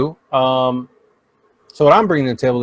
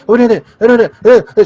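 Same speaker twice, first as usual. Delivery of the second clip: mocking babble; 8 semitones higher, 7 dB louder, faster than usual. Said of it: "Just let me talk".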